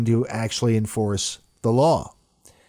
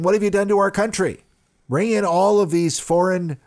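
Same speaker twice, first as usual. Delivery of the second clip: much higher, faster, rising into an outraged demand; indignant outburst; 8 semitones higher than usual. Retold or imitated. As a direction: channel her fury at the government, fast and high. Paraphrasing Le Pen's angry speech.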